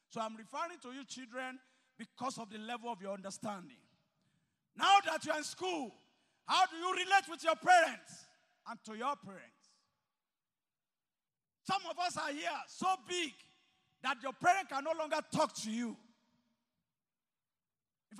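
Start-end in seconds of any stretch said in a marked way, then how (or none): 4.8-16.0 loud, high, driving sermon voice; preaching at full force. Acted. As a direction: press it on the congregation, rising high and loud. Preacher raises voice to congregation.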